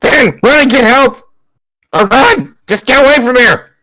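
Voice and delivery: high, desperate yell; urgent yelling. Acted. Grabbed character tells Finn to run, urgent yell. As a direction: yell for someone to flee. Captured character yells to panicked child.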